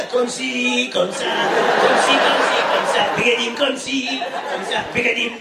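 mocking French singsong of קונסי קונסה; laughing parody of French קונסי, קונסה. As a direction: tease him with a fake French comme ci comme ça. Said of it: "mocking French comme ci comme ça".

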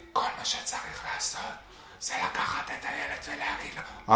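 a hushed, breathy whisper, barely voiced. Mother whispering advice to her son on speakerphone, whispered. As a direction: whisper it like a secret over the phone. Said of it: "אבל למה בלחישות?".

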